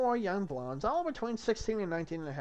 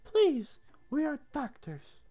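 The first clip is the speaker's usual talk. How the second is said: mildly irritated, "please" pushed higher and louder; slightly irritated; 11 semitones higher, 3 dB louder, slower than usual. Acted. Piglet to a resisting patient, slightly irritated. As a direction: insist with a touch of irritation. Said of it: "Piglet, slightly irritated".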